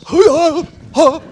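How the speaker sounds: loud, high, sudden hoo-ah of a wobble; a startled yelp as the bike starts to shake; bike shimmy noise. Retold or imitated. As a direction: blurt out the lurch of a bike. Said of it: "it goes".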